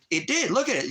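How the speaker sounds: venting real fury, pitch climbing; angry rant. Real, not acted. Heated rant about YouTube.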